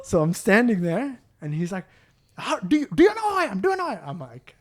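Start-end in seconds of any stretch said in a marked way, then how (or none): 2.4-4.1 outraged customer. Retold or imitated. Customer repeats question.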